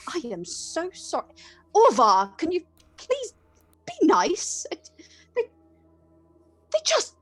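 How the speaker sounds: mildly flustered priest; appalled priest. Acted. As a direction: beg the rude sailor to behave.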